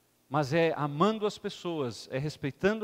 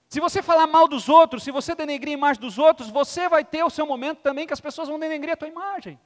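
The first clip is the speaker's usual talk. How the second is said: higher, louder emphatic warning; preaching; 9 semitones higher, 8 dB louder than usual. Acted. Father warns son about life, emphatic preaching. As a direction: press the lesson home.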